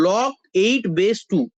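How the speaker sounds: "log eight base two" spoken very slowly, word by word; correct reading order. Shown as a demonstration. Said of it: "উচ্চারণটা এইভাবে হবে".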